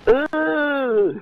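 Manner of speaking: squeaky high giggles through the words; breaking into helpless high laughter; giggling. Real, not acted. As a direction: laugh so hard your voice cracks up high. Laughing at weird tank skin.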